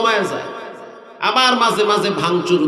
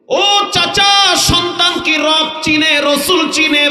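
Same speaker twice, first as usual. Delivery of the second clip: calling out; 6 semitones higher than usual. Retold or imitated. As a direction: cry out to the old man. Preacher calls out to old man.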